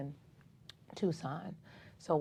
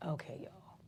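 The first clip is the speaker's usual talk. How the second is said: whispered aside; 2 semitones lower, 11 dB quieter than usual. Retold or imitated. Retold reaction to malignant result.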